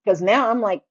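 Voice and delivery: ordinary talk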